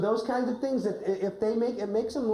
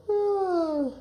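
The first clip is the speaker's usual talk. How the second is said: high pretend sigh of a full belly; a satisfied, stuffed wolf ah; mock ah; 11 semitones higher than usual. Shown as a demonstration. Coach showing the full wolf a high contented ah. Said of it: "Wolf, can you go".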